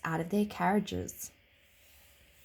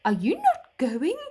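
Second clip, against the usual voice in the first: deep gentle horse voice; 4 semitones higher, 7 dB louder than usual. A narrator's reading. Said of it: "asked a deep, gentle voice".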